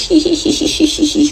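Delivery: a high, soft, mock giggle; imitating his creepy giggle. Mocking his odd laugh to the listener, high, soft giggle. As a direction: giggle the way he did, up high and quiet. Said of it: "He's doing all like this".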